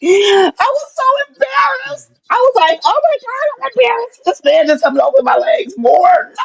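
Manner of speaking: high-pitched laugh-crying; laughing shriek. Real, not acted. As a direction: squeal through tears of laughter. Retelling embarrassing exam, crying laughter.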